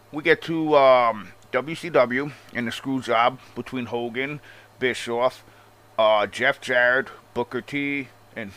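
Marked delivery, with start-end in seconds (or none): none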